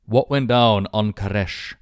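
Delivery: usual speaking voice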